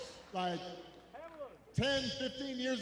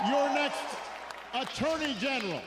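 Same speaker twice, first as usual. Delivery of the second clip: rally speech; 4 semitones higher, 7 dB louder than usual. Real, not acted. Rally call for candidates.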